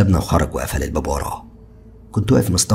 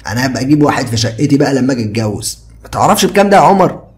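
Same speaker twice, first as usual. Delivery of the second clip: higher, louder easygoing friend; carefree young man, higher and louder; Ziad's casual voice; 7 semitones higher, 6 dB louder than usual. A narrator's reading. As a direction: toss it off lightly like a carefree buddy. Ziad chatting about jacuzzi.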